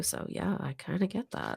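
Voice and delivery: hushed wry understatement; a breathy under-the-breath remark; whispered aside. Real, not acted. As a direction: drop to a dry whisper. Dry aside after gun story.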